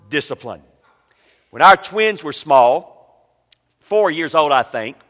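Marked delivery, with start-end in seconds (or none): none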